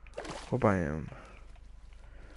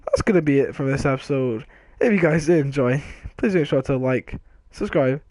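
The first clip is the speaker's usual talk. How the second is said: YouTube outro; 4 semitones higher, 7 dB louder than usual. Acted. Outro sign-off to viewers.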